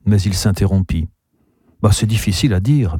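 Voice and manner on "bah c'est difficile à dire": ordinary delivery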